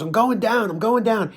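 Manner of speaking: high imitation of dad; mock eager announcement. Dad announcing his line drop while trolling, mock eagerness. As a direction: call it out high, as an excited fisherman.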